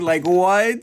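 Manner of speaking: loud, high-pitched disbelief; incredulous. Real, not acted. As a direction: burst out baffled at what you just read. Reacts to Otoya's flirty line.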